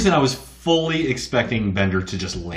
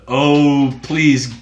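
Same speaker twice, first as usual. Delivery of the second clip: pleading prayer; 3 semitones higher, 7 dB louder, much slower than usual. Retold or imitated. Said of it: "what does he say? Like".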